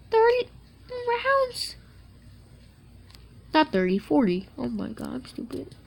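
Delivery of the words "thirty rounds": much higher, mock squeal; high squeal of the same count; squeaky high. Repeats the count, squeaky second.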